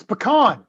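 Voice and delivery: "pecan" as puh-KAHN; Southern pronunciation. Real, not acted. Southerner answers the pecan question, puh-KAHN. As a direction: stress the second half of "pecan", puh-KAHN. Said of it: "I'm Southern".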